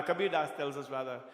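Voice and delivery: ordinary delivery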